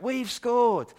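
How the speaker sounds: high, loud cheer from the stands; stadium crowd shout. Retold or imitated. Preacher imitating the stadium crowd's cheer. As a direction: yell it with the whole stadium. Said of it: "all the crowds in the stadium shout".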